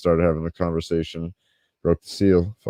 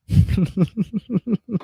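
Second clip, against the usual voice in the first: high laugh. Real, not acted. Laughs after World star joke.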